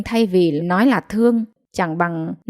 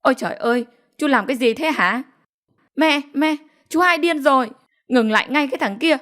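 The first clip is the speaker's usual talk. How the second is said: crying out in alarm; 6 semitones higher, faster than usual. A narrator's reading. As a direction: call for mother to stop the madman. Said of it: "bác Tư thì la lên oai oái". (la oai oái hoảng hốt)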